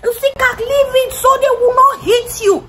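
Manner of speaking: high, pleading scream; auntie screaming. Retold or imitated. Auntie screams at her niece to back off from Tim. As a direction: shriek it like a panicked auntie. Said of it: "Auntie screaming".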